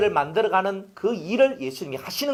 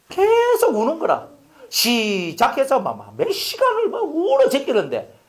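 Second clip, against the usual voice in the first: exasperated; 7 semitones higher, slower than usual. Retold or imitated. Baby crying for hours.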